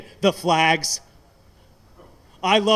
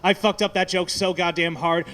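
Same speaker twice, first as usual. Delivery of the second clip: ordinary talk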